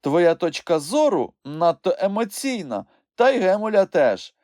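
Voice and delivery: high, loud courtroom voice; judge ruling firmly. A narrator's reading. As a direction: overrule and move the trial on. Judge rules and calls witnesses.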